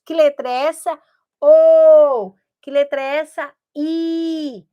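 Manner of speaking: "O" called out loud as a class answer; teacher quizzing. Shown as a demonstration. Teacher playing a reading test with the class, answer called out loud. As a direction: quiz the class, then belt the answer "O". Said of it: "duas letras bem separadas".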